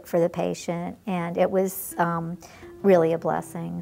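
usual speaking voice

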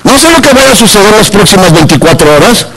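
loud, forceful sermon delivery; full-force preaching. Real, not acted. Preacher exhorting churchgoers to serve and pray, loud fervent preaching. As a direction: preach it out loud to the whole congregation.

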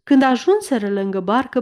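plain reading voice

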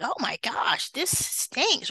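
swooping high pitch on the exclamation; mock disgust. Nurse reacting to a smelly patient, mock disgusted. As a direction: recoil at a bad smell, voice jumping up. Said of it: "It may smell really bad".

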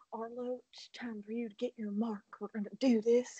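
hushed mock whisper of the dad; whispered dad line. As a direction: breathe it out like a secret. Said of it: "He doesn't say that line just like that".

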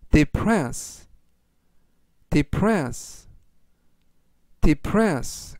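depress read slowly and deliberately; slow word reading. Shown as a demonstration. Teacher reading today's words for repetition, slowly. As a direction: say depress slowly for learners to echo. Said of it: "제가 천천히 세 번씩 읽어드리고요".

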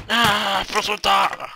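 loud, high yelled attack call; battle cry. Pretend. Gamer attacking an enemy, loud battle cry.

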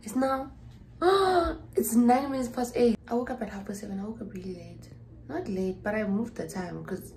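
normal talking voice

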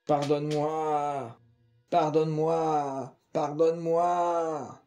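very slow, drawn-out dying plea; long rattling moan. A narrator's reading. Dying old woman begging Étienne for forgiveness, slow drawn-out plea. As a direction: stretch each word like a last breath. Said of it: "Dans un long râle".

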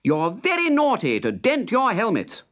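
high, mock-stern telling off; scolding king. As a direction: scold the soldiers like naughty children. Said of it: "Then he called out to the other soldiers".

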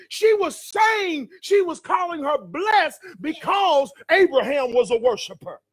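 high, shouted sermon climax; full-force preaching. Real, not acted. Preacher rousing the church to declare they're blessed, full-force preaching. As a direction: drive the congregation to shout along.

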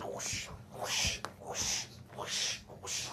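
breathy whooshing noise; whoosh sound. Shown as a demonstration. Teacher voicing washing machine arms for kids.

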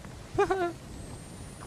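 airy high-pitched relieved laugh; relieved giggle after surviving; breathless high laugh. Real, not acted.

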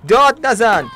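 loud, high yells at the fighter; shouting at fighter. Pretend. Gamer yells at his fighter in the arena, loud high shouts. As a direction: bark orders at a cowardly swordsman.

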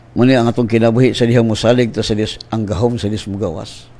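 normal talking voice

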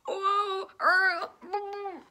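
high, wobbly dog groans; hammed-up noises of distress; shaken groans. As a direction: moan as if being shaken about. Dog being shaken by the owl.

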